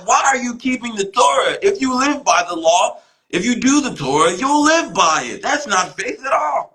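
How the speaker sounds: hammed-up outraged voice climbing high; mock incredulous Paul. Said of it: "He was like".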